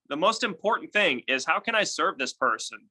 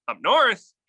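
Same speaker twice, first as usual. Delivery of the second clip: up north echoed higher, louder, as a question; parroting question; 7 semitones higher, 6 dB louder than usual. Shown as a demonstration. Agent parroting the seller's words back as a question. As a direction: toss the last words back curious. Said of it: "repeat the last few words ... with an upward inflection".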